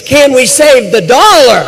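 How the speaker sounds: ringing rhetorical question to the crowd; rally punchline. Real, not acted.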